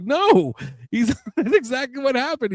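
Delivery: high, laughing through the words; giggling as he insists it's true; cracking up while retelling. Real, not acted.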